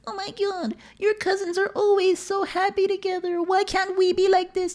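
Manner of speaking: very high, soft falsetto wail; mock mom. Son mimics mom envying the cousins, mocking falsetto. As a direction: whine it in a squeaky, put-on mother voice. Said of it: "And she's like".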